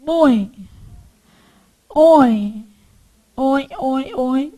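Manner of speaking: oi said with the nose buzzing on top; nasal oi demo. Shown as a demonstration. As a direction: hum oi so the nose vibrates. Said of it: "Faz assim".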